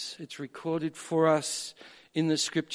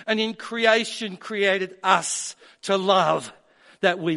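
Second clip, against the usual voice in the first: much higher and louder, fervent preaching; fervent preaching climax, higher and louder; full-force preaching; 7 semitones higher, 5 dB louder than usual. Acted.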